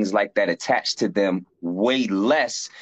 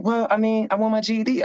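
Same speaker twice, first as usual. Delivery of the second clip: quoted fellow inmate, pitched much higher; voicing the GED classmate; 13 semitones higher than usual. GED classmate pestering Brian, in a higher voice. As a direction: play the curious young brother asking questions. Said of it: "He was like".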